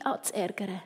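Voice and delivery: plain reading voice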